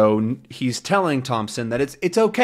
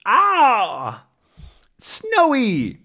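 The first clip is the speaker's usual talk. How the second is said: excited cry; 11 semitones higher, much slower than usual. Acted. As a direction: call out to the dog, thrilled. Tintin cries out, dog rescues.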